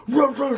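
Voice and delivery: high mock barking dogs; imitating dogs barking high and loud; dog barks. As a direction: bark like a pack of dogs. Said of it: "all these dogs".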